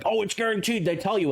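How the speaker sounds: mocking imitation. Streamer imitating misinformed card players, mocking. Mimicking players who claim guarantees.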